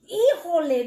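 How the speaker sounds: high, loud oh no; surprised exclamation. Acted. Papi Mouse remembering the guest, surprised. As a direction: realize it suddenly. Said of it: "Papi said".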